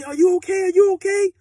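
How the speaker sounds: imitating a frantic girlfriend. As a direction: ask like she is terrified for him. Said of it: "Now she frantic".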